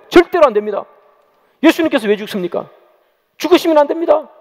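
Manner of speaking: insistent protest, climbing high at the end. Acted. Peter refuses to let Jesus die, protesting. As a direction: plead firmly against it, acting Peter. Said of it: "항변을 하는 거예요".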